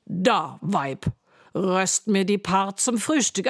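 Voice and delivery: loud, slow giant voice; booming giant. Acted. Giant ordering his wife, then sniffing for flesh. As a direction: sniff and boom out each word. Giant demands breakfast, smells boy.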